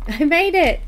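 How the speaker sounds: breathless relief at making it; excited. Real, not acted. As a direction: burst in with a high, loud relief. Arriving late to her livestream.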